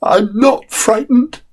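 a small tired boy pretending not to be scared; little boy insisting. Frightened boy Peter in dark.

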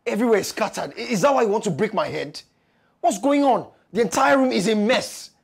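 loud, high-pitched exasperated complaint; irate husband's shouted scolding; angry shouting. Acted. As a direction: raise your voice over the mess. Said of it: "My husband is shouting".

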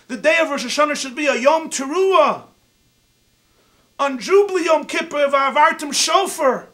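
loud, high, rapid-fire verse citing; heated counterattack. Retold or imitated. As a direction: throw every verse back at her.

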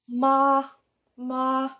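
"ma" held high and level, straight across; sustained high "ma" with no rise or fall; first tone. Shown as a demonstration. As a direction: say "ma" on one high, even monotone note. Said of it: "straight across, like a monotone, very even".

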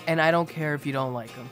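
ordinary talk, nothing marked

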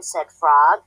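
usual speaking voice

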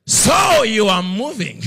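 slow, loud and high, weighty as thunder; God's voice booming down from above. Retold or imitated. God confronting the preacher in the car, slow and booming. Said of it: "the Lord says".

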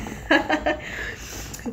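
a short, breathy laugh. Real, not acted. Shouting out her friend Shanae, then a soft laugh. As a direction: laugh it off softly.

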